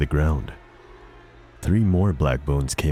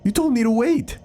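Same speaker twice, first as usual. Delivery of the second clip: furious yell. Acted. Janitor yells at the professor who betrayed him. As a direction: scream the accusation.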